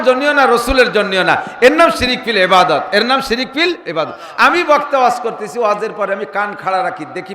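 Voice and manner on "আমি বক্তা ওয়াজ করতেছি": ordinary delivery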